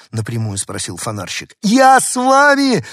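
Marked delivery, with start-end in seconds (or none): 1.6-2.8 very high, loud, slow insistence; little dog stubbornly insisting. Acted. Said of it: "упрямо повторил Дуля".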